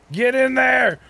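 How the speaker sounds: loud high cheer; excited shout. Real, not acted. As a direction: yell it at the screen. Cheering at game.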